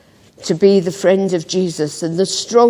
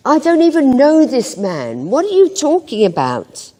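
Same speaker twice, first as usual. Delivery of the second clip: higher and forceful, insistent denial; very strongly; 6 semitones higher than usual. A narrator's reading. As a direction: slam the door on the accusation. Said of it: "Peter said very strongly".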